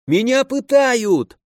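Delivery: high, slow desperate yell; yelling for help. A narrator's reading. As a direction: cry out as if being tortured. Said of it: "заорал за моей спиной степняк". (отчаянный крик о помощи)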